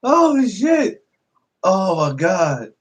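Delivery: amused shock. Real, not acted.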